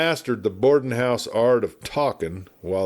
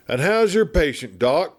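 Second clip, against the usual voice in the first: talkative frontier lawman, raised a bit in pitch; a chatty old westerner spinning yarns; garrulous old marshal; 6 semitones higher than usual. Acted. Garrulous marshal rambling at the doctor, lightly voiced.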